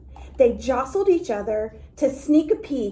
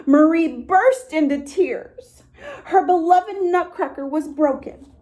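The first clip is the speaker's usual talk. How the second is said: tearful narration; 5 semitones higher than usual. A narrator's reading. Marie crying in story.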